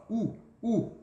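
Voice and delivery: U as a rounded-lip "oo"; isolated U, demonstrated twice; contrasting U sound. Shown as a demonstration. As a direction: say U with pouted lips, sounding unlike French. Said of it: "fica diferente, ó".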